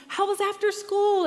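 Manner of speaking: forced cheer. Retold or imitated. Mom cheerfully pressing her sullen son, loud high cheer. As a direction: pour on the cheer to win him over. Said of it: "I'm gonna pass on my cheer to him".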